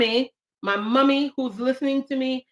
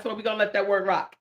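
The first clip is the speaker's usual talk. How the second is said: ordinary delivery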